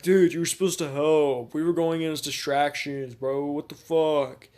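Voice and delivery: loud, high shouted complaint; indignant teammates. Acted. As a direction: yell in outraged protest at being left hanging. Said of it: "They're like".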